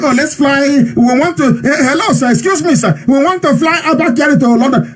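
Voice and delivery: imitating groveling Nigerian officials asking to fly to London; mock pleading officials. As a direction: simper like a fawning official begging a favour. Mocking officials begging Britain.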